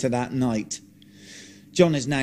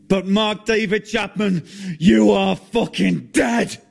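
slow, loud threat, pitched up; menacing threat; 7 semitones higher, 4 dB louder than usual. Acted. John threatening his killer into the camera, menacing. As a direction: aim it at the killer through the lens. Points directly into the camera lens.

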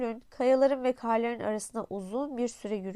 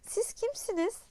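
high, amazed question; the prince amazed at a garden of roses; astonished; 10 semitones higher, 3 dB quieter than usual. A narrator's reading. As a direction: gasp it out in wonder. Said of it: "Şaşkınlıkla".

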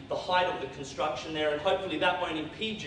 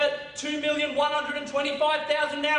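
auctioneer calling; 11 semitones higher, 4 dB louder than usual. Acted.

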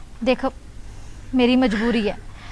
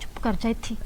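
urgent soft hush, much softer; 12 dB quieter than usual. Real, not acted. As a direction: shush her so nobody hears. Hushing her so nobody hears.

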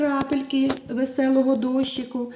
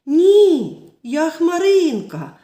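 high, loud protesting cry, the name drawn out slowly; cloud shouting; 6 semitones higher, 8 dB louder, much slower than usual. Acted. Cloud insisting on her name, loud desperate shout. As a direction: shout it so no one can ignore you. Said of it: "А вона все кричала".

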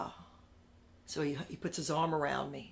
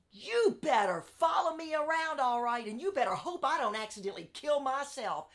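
defiant warning; 8 semitones higher than usual. Retold or imitated. Warning the editor on the phone.

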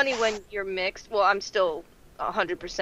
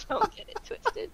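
high squeaky voice; 14 semitones higher, 9 dB quieter than usual. Acted. Teasing friend about Bollywood.

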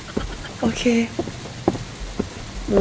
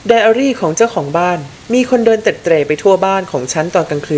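lower, measured reading voice for the diary; 5 semitones lower than usual. Acted. Streamer reading a found diary to viewers, low and measured. Reads in-game diary aloud.

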